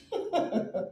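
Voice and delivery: a soft high giggle; high laugh. Real, not acted. Amused by the young man's messages, high giggle.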